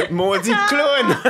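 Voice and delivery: slow high laughing insult; laughing mother. Retold or imitated. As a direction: drag it out through laughter. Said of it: "elle était comme". (mère qui rit en lançant l'insulte)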